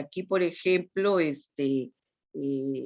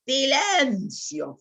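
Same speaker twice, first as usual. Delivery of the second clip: drawn-out commanding "Silencio" said higher and slowly; loud command; 8 semitones higher, much slower than usual. Shown as a demonstration. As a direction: call "Silencio" out like a strict order. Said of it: "fuerte, puedo gritar". ("Silencio" dicho fuerte y alargado)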